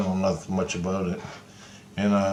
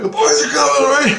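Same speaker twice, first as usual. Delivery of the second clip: loud, high-pitched re-enacted cheer; excited cry; 7 dB louder, faster than usual. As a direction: crow it out as the reinforcements arrive. Acts out his excited battle cry.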